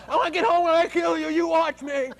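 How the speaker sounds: put-on high, loud angry yell at the kids; mock screaming mother. Coworker screaming at her kids on the phone. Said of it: "she's screaming at 'em on the phone".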